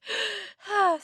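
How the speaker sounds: long audible sigh, falling; heavy exaggerated sigh; demo sigh. Shown as a demonstration. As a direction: let out a big falling sigh.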